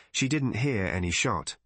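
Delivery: ordinary delivery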